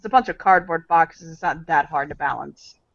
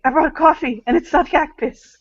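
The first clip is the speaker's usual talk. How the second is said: high eager character voice; 8 semitones higher than usual. Acted.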